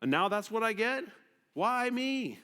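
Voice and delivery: poor and complaining, pitch rising; self-pitying whine. Retold or imitated. Poor believer complaining to God, mock self-pitying whine. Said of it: "You say".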